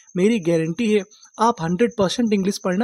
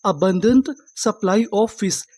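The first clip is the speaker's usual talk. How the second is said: "The river has an abundant supply of fish" read very slowly, word by word; slow example sentence; much slower than usual. Shown as a demonstration. Teacher reading an example sentence to students, very slow and clear. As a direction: spell out each word slowly for the class. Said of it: "इसका एक sentence देख लेते हैं".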